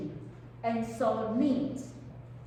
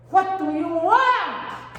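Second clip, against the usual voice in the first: loud, slow, every word weighed; firm counselor question; 4 semitones higher, 9 dB louder, much slower than usual. Shown as a demonstration. Therapist pressing a whining client, loud and slow. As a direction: ask it firmly, pinning them down. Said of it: "you have to ask him or ask her".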